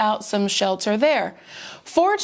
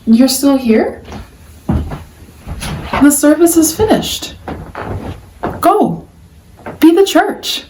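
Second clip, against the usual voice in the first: calling out; 3 semitones higher, 7 dB louder, slower than usual. Real, not acted. Calling out the dismissal.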